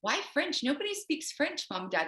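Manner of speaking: high, whiny kid voice, a little mock; childhood protest. Girl in Alabama mock-protests learning French to her parents. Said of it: "And I was like".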